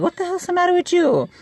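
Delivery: high-pitched incredulous question; puzzled. Retold or imitated. Family baffled at kids running off screaming. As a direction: ask it like you can't believe your eyes.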